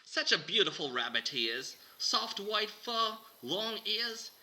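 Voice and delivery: loud, high Aussie host shouting; hammed-up Australian presenter; Australian accent. [name] showing Shannon the rabbit, loud Australian accent. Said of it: "I will try to do mine in an Australian accent".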